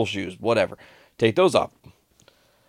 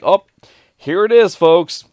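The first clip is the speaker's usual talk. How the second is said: high, loud showy call-out; acted-out public discovery; mock announcement; 9 semitones higher, 11 dB louder, slower than usual. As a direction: call it out to the crowd, hammed up. Acts sheepishly announcing the found phone.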